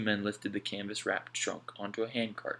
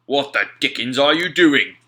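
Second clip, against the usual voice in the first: much louder, sharp rebuke; Slank, sharp challenge; 5 semitones higher, 14 dB louder than usual. A narrator's reading. Slank catching Alf at the trunk, loud rebuke. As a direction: stop him in his tracks.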